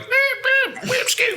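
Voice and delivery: mock the old wrestling trainer in a tiny high voice; impression of old trainer. Old trainer answering a wannabe wrestler, squeaky high mock voice. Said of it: "that's my impression of him".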